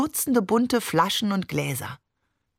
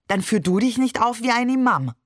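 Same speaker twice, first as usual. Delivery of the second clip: higher, cutting reproach to close the argument; angry jab; 6 semitones higher than usual. Acted. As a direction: throw the insult back at him. Claire snaps during quarrel. (scharf und verärgert)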